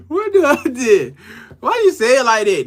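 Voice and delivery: high, laughing read of a viewer's comment; laughing quote. Retold or imitated. Host laughing as he reads a viewer's teasing comment. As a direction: read her comment while cracking up. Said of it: "She said".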